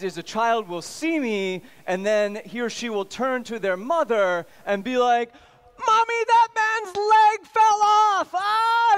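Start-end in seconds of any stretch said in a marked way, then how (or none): 5.9-9.0 put-on high, slow shrill kid voice shouting; a little kid shrieking to mommy; child's voice. Small child shrieks to mother, high and loud.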